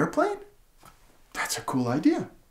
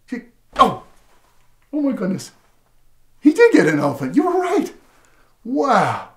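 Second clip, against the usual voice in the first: higher, louder hammed-up amazement; mock surprise; 7 semitones higher, 6 dB louder than usual. Reading to a child, hammed-up surprise. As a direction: fake astonishment for the child.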